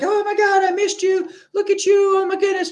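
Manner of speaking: very high, gushing mock greeting; hammed-up falsetto excitement; high-pitched excited voice. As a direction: imitate an owner squealing hello to a dog. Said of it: "don't walk in the door and be like".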